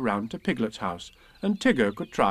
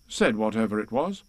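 plain reading voice

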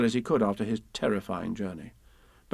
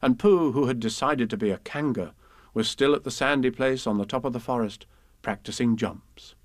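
plain reading voice

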